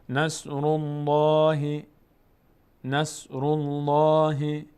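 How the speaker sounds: slow chanted "الله" with heavy L; full heavy Allah. Shown as a demonstration. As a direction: open the mouth upward on a long, rounded "الله". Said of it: "دهان به سمت بالا باز میشه".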